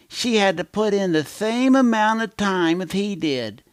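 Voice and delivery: put-on high, lisping baby talk. Shown as a demonstration. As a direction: tell it like a pouting big baby. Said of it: "use my baby voice".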